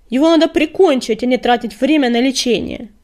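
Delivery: loud, high-pitched angry demand; angry outburst. A narrator's reading. Deputy demands the prisoner's death, furious and loud. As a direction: raise your voice in outrage.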